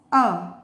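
final "a" said uh, as starts under; short U sound. Shown as a demonstration. Teacher explains short U to learners, "a" said uh. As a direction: end on "a" sounded as uh. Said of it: "The short sound of U".

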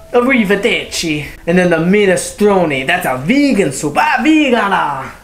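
hammed-up Italian accent, high and swooping; mock Italian accent. Reviewer showing off Italian words, hammed-up Italian accent. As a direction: ham it up like a stage Italian. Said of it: "what are some Italian words?".

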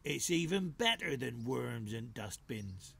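slow, grinning badger voice; scheming badger boss, slow and pleased; sly badger. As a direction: savour it with a sly grin. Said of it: "grinning a badgerish grin".

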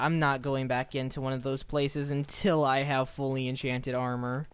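ordinary talk, nothing marked

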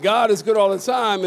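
full-force call to the congregation; said like you mean it. Shown as a demonstration. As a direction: belt it out so the church answers. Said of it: "say it like you mean it".

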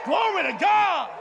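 high, shouted revival cadence; full-force preaching. Real, not acted. Preacher promising great grace and victory, fervent shouted preaching. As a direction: drive each phrase out to the crowd.

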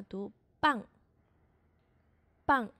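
teacher punching out a heavy 棒; heavy tense consonant. Shown as a demonstration. Teacher showing students the tense b, heavy and strong. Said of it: "注意我的发音是很重的".

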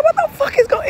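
very high-pitched baffled exclamation; retold bewilderment. Speaker retelling her confused thought in bed, high bewildered voice. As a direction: squeal it in mock bewilderment.

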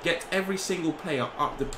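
ordinary talk

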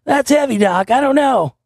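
mock Marty McFly, whiny and high. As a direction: do the bewildered teenager. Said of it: "a fucking Marty McFly impersonation".

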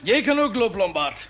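high, loud send-off; curt dismissal. Acted. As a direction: order him out. Dismissing the official curtly.

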